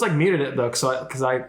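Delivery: ordinary talk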